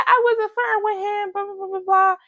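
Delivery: very high-pitched squeaky mimicry; mock protest. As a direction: imitate an indignant denial way up high, running into blahs. Acted protest of accused girlfriend.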